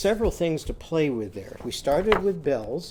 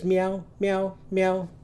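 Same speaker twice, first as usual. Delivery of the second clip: higher mewing kitten; high kitten meow; 5 semitones higher, slower than usual. Shown as a demonstration. Teacher mewing like a kitten for kids. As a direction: mew like a tiny kitten. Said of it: "little kitten, she says".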